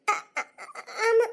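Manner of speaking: stammering through sobs; halting, sob-broken reply; tearful. Acted. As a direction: choke out the admission. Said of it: "ஆ, சரி, இப்ப எப்படி அழுத".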